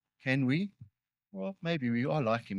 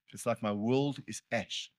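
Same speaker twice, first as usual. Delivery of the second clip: ordinary talk, nothing marked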